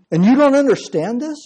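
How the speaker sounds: high-pitched disbelieving question; incredulous challenge. Acted. Jesus challenging Israel's teacher for not understanding, incredulous.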